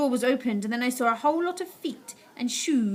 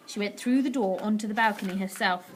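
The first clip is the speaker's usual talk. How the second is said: ordinary delivery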